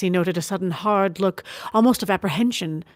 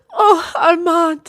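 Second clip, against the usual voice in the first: slow, higher, tearful sigh; quaint tearful sigh; 10 semitones higher, 6 dB louder, much slower than usual. A narrator's reading. Sister sighing at her brother's virtues, tearful. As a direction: sigh his name through tears. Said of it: "she said quaintly".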